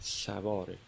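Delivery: quiet afterthought echo of سواره; soft echo. Acted. Echoing the key word.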